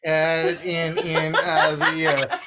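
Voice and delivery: Southern drawl, held through the whole rant. Acted. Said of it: "thank you for noticing my particular accent".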